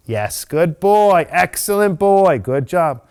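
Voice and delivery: slow, high, loud drawn-out praise; praise for dog. Real, not acted. Trainer praising dog after good choice, drawn-out praise. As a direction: gush over the dog, stretching each word.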